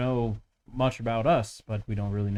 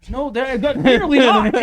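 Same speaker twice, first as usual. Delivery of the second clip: high loud laughing protest; 13 semitones higher, 11 dB louder than usual. Real, not acted. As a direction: laugh while denying.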